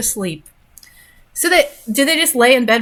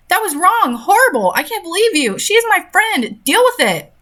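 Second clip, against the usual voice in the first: upset outburst; 9 semitones higher than usual. Acted. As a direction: yell it at your mom, hurt and furious. Daughter confronting mom in story.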